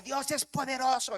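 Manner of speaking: high, loud cry of praise; retold shouting. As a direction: proclaim it loudly, as a young zealous believer. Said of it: "gritaba y, y decía".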